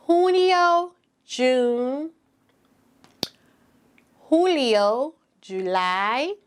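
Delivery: slow, patient teaching voice; sing-song teacher pacing; kindergarten teacher voice. Shown as a demonstration. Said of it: "I'm going to say them in Spanish".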